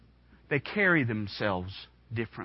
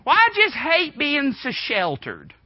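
mock whiny teen, higher and slower; whiny teenager; 11 semitones higher, 6 dB louder, slower than usual. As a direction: whine it like a sulky fourteen-year-old. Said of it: "what you hear all the time".

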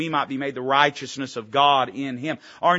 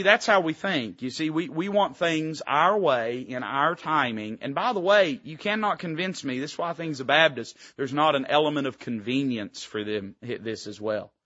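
ordinary talk, nothing marked

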